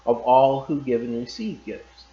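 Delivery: ordinary delivery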